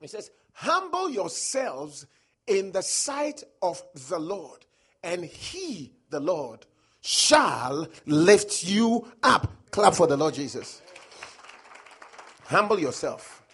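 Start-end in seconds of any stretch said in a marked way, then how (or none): 0.5-9.5 drawn out, every word weighted; slow emphatic scripture. Acted. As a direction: read it slowly like a proclamation. Preacher reading the key verse.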